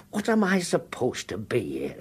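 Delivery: hushed aside, breathy and unvoiced; a whispered grumble between the two. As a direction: mutter it under your breath so they can't hear.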